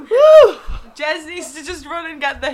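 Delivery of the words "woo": cheering shout. Real, not acted. Cheering at podcast intro.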